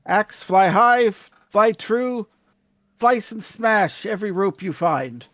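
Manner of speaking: incantation to the axe. Acted. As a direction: intone it like a spell, slowly. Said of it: "What will he say to it".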